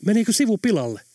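a touch of alarm over possible damage; mildly frightened narrated voice; startled. A narrator's reading. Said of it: "kysyi Helena säikähtäen".

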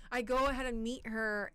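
ordinary talk, nothing marked

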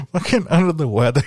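high, loud, fast laughing scoff; laughing mockery. Real, not acted. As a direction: laugh at the lame excuse. Mocking friend's excuse while laughing.

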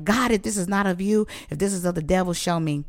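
ordinary talk

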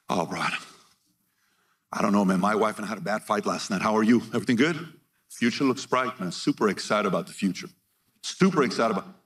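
low, laid-back acted chat; understated excitement in a low voice; relaxed optimist. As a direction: play someone calmly unbothered. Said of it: "walk around the room like this".

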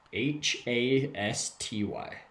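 slow letter-by-letter spelling. Real, not acted. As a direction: give each letter its own beat. Spelling the name for listeners.

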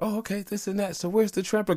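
high, lightly put-on kid voice; the daughter asking where the trampoline is; voicing his kid. A hint of a whiny child. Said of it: "I'm like".

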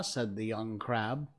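usual speaking voice

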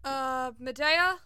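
slow, loud questioning call, rising at the end. Acted. Crewmate at the door asks to come in, calling out. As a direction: call through a door for attention.

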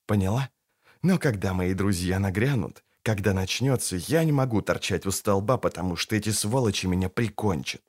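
low hushed urgency; urgent whisper. A narrator's reading. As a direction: whisper fast and tense. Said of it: "шепнул Кайлей".

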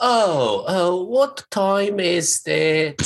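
slow, stretched posh British voice; drawn-out mock-posh British; posh British accent. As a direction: attempt an upper-class British accent. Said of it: "more like a posh British".

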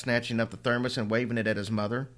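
usual speaking voice

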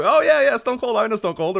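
hammed-up fan excitement; mock excited fan. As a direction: gush like an eager wrestling fan. Imagined fan recognizing Stone Cold.

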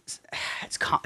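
breathy sigh trailing into a whisper; sigh, then drop to a whisper; sighing half-whisper. Real, not acted. Friend explaining Minecraft crafters, sighing into a whisper.

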